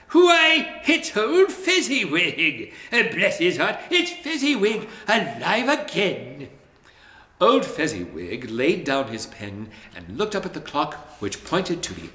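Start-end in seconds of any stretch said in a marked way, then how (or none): 0.1-6.5 high, laughing delighted cry; overjoyed to see him alive; great excitement. A narrator's reading. Scrooge seeing his old master again, high laughing joy. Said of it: "Scrooge cried in great excitement".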